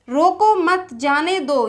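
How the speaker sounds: "रोको मत" run together, break, then "जाने दो"; pause after मत. Shown as a demonstration. Teacher quoting the speaker's words, pause after मत. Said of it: "वक्ता ने कहा है".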